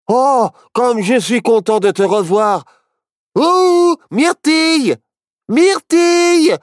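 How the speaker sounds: high, loud joyful calling; rising to high-pitched yells; crying out. A narrator's reading. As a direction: shout to a friend you're glad to see. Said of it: "cria Barnabé".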